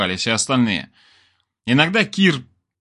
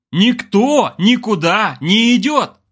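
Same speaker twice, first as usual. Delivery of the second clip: loud, high, slow word-by-word roar; commanding bark; 9 semitones higher, 5 dB louder, slower than usual. A narrator's reading. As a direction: shut down all argument, stretching every word. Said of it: "рявкал Олег".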